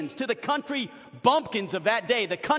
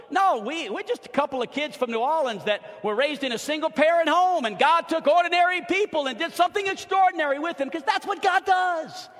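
rising, louder and higher to a cry; preaching at full force; 6 semitones higher, 4 dB louder than usual. Acted. Pastor telling the church his humble roots, building to a high, loud cry. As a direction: build the testimony to a shout.

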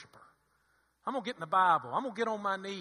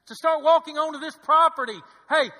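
loud, rousing pulpit cry; rally the church with rising fervor; full-force preaching; 6 semitones higher, 12 dB louder than usual. Acted. Preacher praying the church shows up with praise, loud fervent preaching.

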